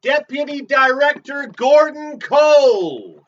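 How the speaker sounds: loud, high, drawn-out shout; booming announcement. Acted. Podcaster hamming up a character's name, booming announcement. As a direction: bellow the name slowly.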